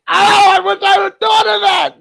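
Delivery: high-pitched, delighted outburst; squealed exclamation; excited shout. Real, not acted. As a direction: cry out high, wishing the joke were yours. Exclaims over the accidental joke.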